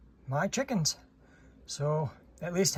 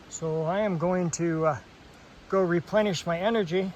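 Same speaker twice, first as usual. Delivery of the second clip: usual speaking voice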